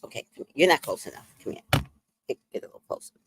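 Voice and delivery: hushed, breathless coaxing at the screen; whispered coaxing. Real, not acted. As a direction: whisper it, luring someone in.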